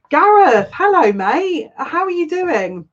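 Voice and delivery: light up and stretch the greeting out slowly; delighted greeting. Real, not acted. Host greeting a viewer she hasn't seen in ages, delighted and high.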